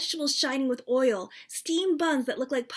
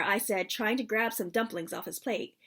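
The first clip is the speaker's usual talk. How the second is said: plain reading voice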